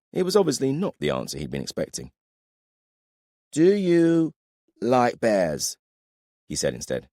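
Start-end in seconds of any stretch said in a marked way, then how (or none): 3.5-5.8 put-on drunk. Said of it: "You're drunk".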